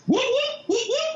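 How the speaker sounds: high falsetto whoop-whoop imitating a police siren; siren imitation. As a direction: mimic the siren wailing, way up high.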